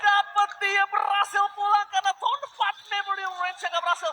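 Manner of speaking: hyped esports play-by-play shout; fighting game announcer. Acted. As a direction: yell the big steal call. Live esports casting climax.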